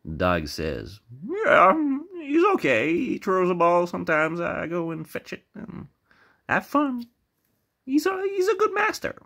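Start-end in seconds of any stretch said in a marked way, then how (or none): 1.2-9.2 very high put-on doggy voice; talking dog. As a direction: chat in a squeaky cartoon dog voice. Dog speaks in joke.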